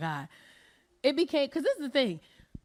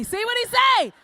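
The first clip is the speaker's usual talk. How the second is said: higher and louder repeat, shouted; rising shout; 8 semitones higher, 3 dB louder than usual. Acted. Comic urging the men to ask a friend, shouted. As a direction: push it up into a yell.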